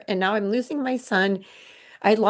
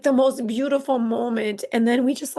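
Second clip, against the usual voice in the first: ordinary delivery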